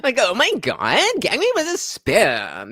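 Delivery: put-on high, lilting Valley girl upspeak; hammed-up Valley girl, pitch swooping up; mock Valley girl.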